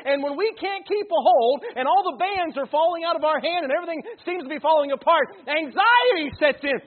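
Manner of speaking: loud, high, fervent preaching; preaching at full force. Acted. Pastor preaches to the congregation about anxiety, loud and fervent. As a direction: drive the point home to the whole church.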